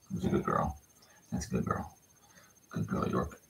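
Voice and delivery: soft unvoiced whisper to a pup; hushed whisper of praise. Real, not acted. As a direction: breathe the praise gently.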